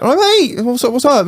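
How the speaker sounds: high-pitched, chirpy reply; acted startled greeting. Worker answering the delivery driver, high mock-friendly greeting. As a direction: answer up high, quick to respond.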